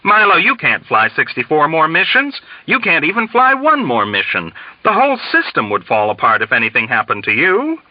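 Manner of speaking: high-pitched, rushed exasperation; exasperated, frantic colonel. A narrator's reading. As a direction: plead in a frantic fluster. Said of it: "in frantic exasperation".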